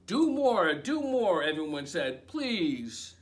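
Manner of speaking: lively picture-book read-aloud; reading to children. A narrator's reading. Librarian reading a picture book to children, animated. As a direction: read it like bedtime story time.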